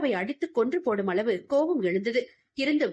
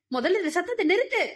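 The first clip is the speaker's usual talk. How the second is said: higher, rising demand; sharper scold; 8 semitones higher than usual. Acted. An elder repeating the order to stop, higher and insistent. Scolding a woman laughing madly.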